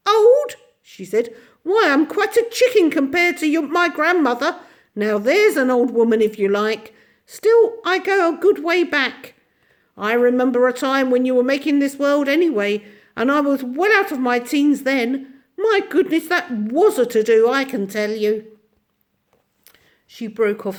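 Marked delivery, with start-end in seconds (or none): 0.1-0.6 high, loud shriek of amusement; shrieking laugh. Acted. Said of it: "shrieked with laughter". 1.6-18.6 put-on high, bright old little woman voice; tiny ancient shopkeeper. Mrs Corey reminisces.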